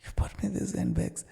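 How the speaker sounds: high, disbelieving question; mock surprised wife. Wife opening the parcel, staged high surprise. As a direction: act the wife's amazed double take.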